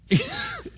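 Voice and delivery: sudden high squeaky laugh. Real, not acted. Preacher laughing at the leaders, high laugh. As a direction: burst out laughing.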